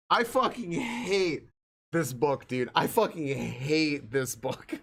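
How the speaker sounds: slow, drawn-out weary loathing; fed-up exasperation. Real, not acted. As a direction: stretch out the loathing word by word. Fed up with the book.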